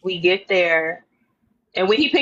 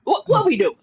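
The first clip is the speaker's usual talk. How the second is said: mock exasperated; 10 semitones higher than usual. Mock pressing him, laughing.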